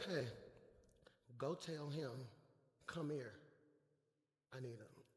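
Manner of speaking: low, soft, unhurried instruction; calm authority, voice dropped right down; quiet summons. Acted. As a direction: murmur it to your crew. Acts Jesus quietly sending crew.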